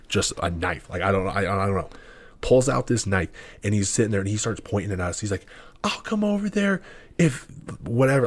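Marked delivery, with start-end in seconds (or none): 5.8-8.3 high, wild threatening rant; mock crazed drunk yelling threats. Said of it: "he was just going on and on".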